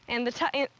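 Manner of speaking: normal talking voice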